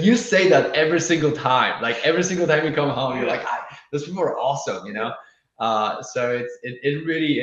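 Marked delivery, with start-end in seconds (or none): 0.0-5.1 teasing. Retold or imitated. Fiance teasing him, quoted.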